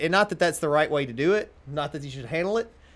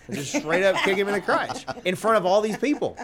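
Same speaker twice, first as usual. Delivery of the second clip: laughing; 5 semitones higher than usual. Real, not acted. Laughing while retelling the kick.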